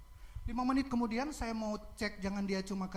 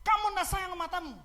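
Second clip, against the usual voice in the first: scolding; 8 semitones higher, 6 dB louder than usual. Retold or imitated. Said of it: "Saya tegur dia".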